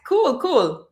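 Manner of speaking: ordinary talk, nothing marked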